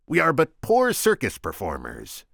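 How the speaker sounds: high, loud Vizzini voice; squeaky scheming Sicilian. Acted. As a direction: pitch him up, sharp and cocky.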